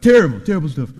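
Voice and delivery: loud, punchy politician impression; half-serious presidential imitation, hit hard; Donald Trump. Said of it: "my best Donald Trump impression".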